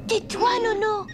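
scolding. Acted. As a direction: snap at him to hush. Child scolds the robot.

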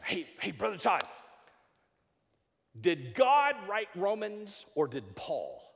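acted congregant buttonholing the pastor; imitated eager questioner. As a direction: call out to the pastor, then ask slowly. Imitating church members calling to him.

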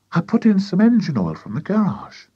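put-on high, eager boy's voice; eager young boy. A narrator's reading. George remembering an ingredient, high and eager.

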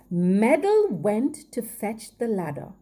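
ordinary delivery

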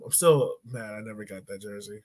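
usual speaking voice

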